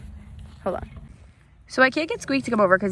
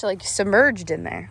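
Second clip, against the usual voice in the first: ordinary talk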